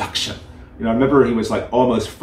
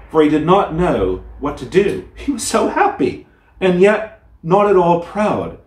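gentle storyteller reading a classic tale; fairy tale reading; 3 semitones higher than usual. A narrator's reading. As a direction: read it like a bedtime story to listeners. Reads fairy tale aloud.

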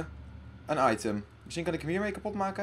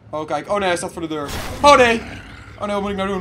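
loud, higher, frightened; panic; 4 semitones higher, 10 dB louder than usual. Real, not acted. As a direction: voice rising in real alarm. Monster at the door. (angstig en luid)

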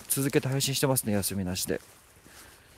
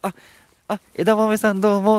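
much higher, louder swooning greeting; type voice; 9 semitones higher, 12 dB louder than usual. Shown as a demonstration. Streamer greets a viewer she likes, in a much higher gushing voice. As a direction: gush at a crush, high and loud. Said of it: "私のタイプなら声変わる".

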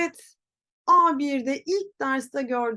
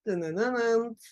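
imitated buzzer noise; wrong-answer buzzer. Shown as a demonstration. Teacher rejects wrong pronunciation, imitated buzzer. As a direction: buzz out a quiz-show error sound.

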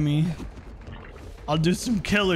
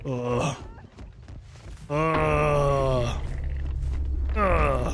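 low drawn-out groans; groaning; 5 semitones lower, much slower than usual. Acted. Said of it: "Just groan".

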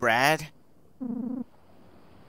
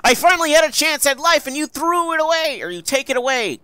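high, loud accusing cry; loud high anguished accusation; anguished shout; 13 semitones higher, 7 dB louder than usual. Acted. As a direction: throw the words at him, hurt and furious. Rereads Buddy's line in role.

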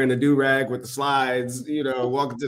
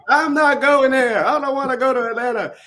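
put-on whiny teen refusal; 13 semitones higher, 6 dB louder than usual. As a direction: whine it like a teenager refusing to go. Retelling refusing to go, whining.

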